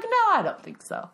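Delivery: put-on very high, sassy child voice; sassy kid. Daughter brushing off mom's offer, high and sassy. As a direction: toss it off like a snarky eight-year-old. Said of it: "She was like".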